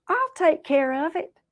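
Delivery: high-pitched volunteering; eager Rabbit. Acted. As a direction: pipe up to offer help.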